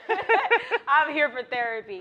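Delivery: high, loud laughing excitement. Real, not acted. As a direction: laugh it out, hyped. Laughing hype about therapy.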